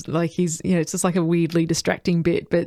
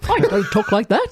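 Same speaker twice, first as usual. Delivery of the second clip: higher second stab at a movie-star impression; badly faked film-star voice, pitched up a little; Cary Grant; 4 semitones higher than usual. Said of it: "that's a second attempt".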